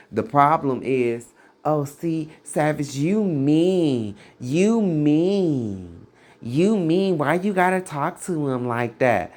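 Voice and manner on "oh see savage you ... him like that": higher, mocking complaint voice; hammed-up offended church lady; mock whiny churchgoer. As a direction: parody a churchgoer clutching their pearls.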